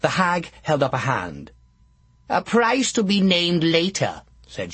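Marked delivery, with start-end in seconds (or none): 2.3-4.2 lightly voiced sly witch; a sly old witch bargaining; hag voice. Hag names her price.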